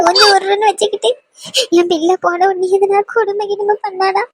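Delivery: high-pitched tearful lament; crying with worry. Acted. Mother fretting to sister-in-law about her only daughter, crying. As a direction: pour out fears through sobs.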